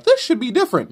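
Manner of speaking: high-pitched mock complaint; mocking newcomer. Comic mocking newcomers who want changes, high mocking voice.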